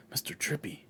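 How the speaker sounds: breathy whispered aside under the breath; a sly whispered dig. Acted. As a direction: slip it in as a whisper. Muttered aside.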